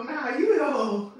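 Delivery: high, loud playful retort; loud mock comeback. Firing a joke back at her friend, loud and high. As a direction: fire the joke back loudly.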